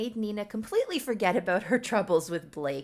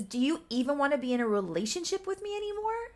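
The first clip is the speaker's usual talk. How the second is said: put-on hurt girlfriend; 7 semitones higher, faster than usual. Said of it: "And she says".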